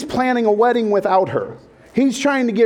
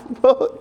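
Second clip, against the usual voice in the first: nervous laugh; 5 semitones higher than usual. Retold or imitated. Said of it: "starts doing this nervous laugh".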